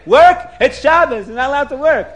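loud, high incredulous outburst; retold scandalized shout; shocked rebuke. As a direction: pounce on it with mock horror. Rabbi appalled by talk of work.

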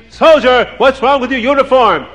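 loud, high angry yell; screaming. A narrator's reading. Tsar faulting a soldier's uniform, screaming. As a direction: holler it like a raging ruler. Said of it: "And the Tsar screams".

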